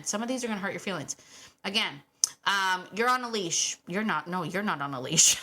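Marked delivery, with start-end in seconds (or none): none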